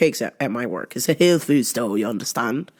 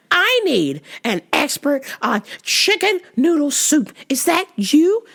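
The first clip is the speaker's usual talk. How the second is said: put-on much higher, fussy older lady on the phone; confused lady caller; 9 semitones higher than usual. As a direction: play the lost caller, pitch way up. Voicing the caller.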